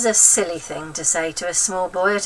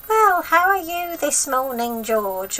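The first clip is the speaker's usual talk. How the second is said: sweet granny; 7 semitones higher, slower than usual. Acted. Grandma mock sweet voice.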